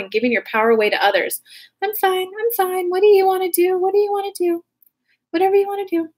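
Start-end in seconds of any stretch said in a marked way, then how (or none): 1.8-6.1 high, sing-song mock voice; people-pleaser mock. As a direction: mimic someone swallowing their feelings, high and sweet. Mocking a people-pleaser's brushed-off reply.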